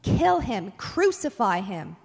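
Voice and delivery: loud crowd chant for blood; shouted back. Acted. Crowd shouting back at Pilate, loud, forceful demands. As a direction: shout each demand hard and separately, falling at the end. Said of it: "They shouted back".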